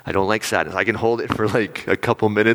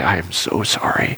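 sad, heartfelt apology; 3 semitones lower than usual. Shown as a demonstration. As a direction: say it from real sadness. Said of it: "And from that sadness, I say".

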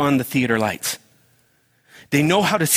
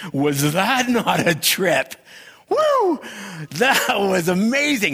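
slow, higher, awed gush with a whooping woo; thrilled moviegoer; 6 semitones higher, slower than usual. Retold or imitated. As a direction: rave like you just left a great movie. Thrilled moviegoer after film.

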